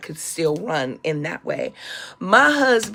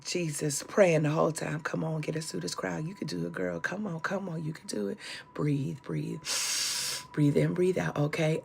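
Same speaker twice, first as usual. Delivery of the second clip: hushed praying; 4 semitones lower, 11 dB quieter than usual. Retold or imitated. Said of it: "Melva was like".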